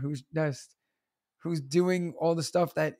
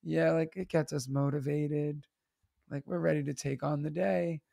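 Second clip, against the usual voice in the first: soft, dejected deadpan; flatly glum motivational talk, softly; sad voice; 3 semitones lower, 6 dB quieter than usual. Retold or imitated. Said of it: "with a sad voice".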